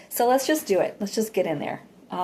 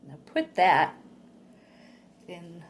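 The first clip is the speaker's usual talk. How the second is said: guided meditation; 4 dB quieter than usual. Acted.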